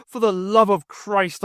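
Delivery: raging at his dead wife; furious outburst. Acted. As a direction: hurl it out in fury. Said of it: "the rage welling up".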